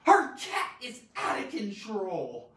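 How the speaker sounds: loud, emphatic exclamation; exclamatory. Shown as a demonstration. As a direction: exclaim it with big emphasis on cat. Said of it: "we put an exclamation point here".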